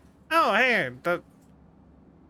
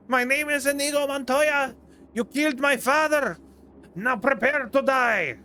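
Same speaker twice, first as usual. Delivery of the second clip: higher hammed-up vengeful duelist; Inigo Montoya impression; 6 semitones higher than usual. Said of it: "trying to do an impression of that".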